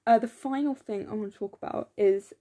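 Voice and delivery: ordinary talk, nothing marked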